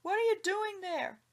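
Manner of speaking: loud, high call across the yard; high, loud shout at the pumpkin face. A narrator's reading. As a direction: hail him sharply from a distance. Farmer calling out at stranger.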